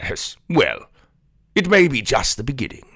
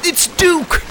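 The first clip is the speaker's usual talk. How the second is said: excited cheering; 7 semitones higher than usual. Acted. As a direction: cheer on the dragon. Cheering the dragon attack.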